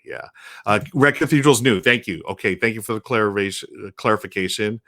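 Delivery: ordinary talk, nothing marked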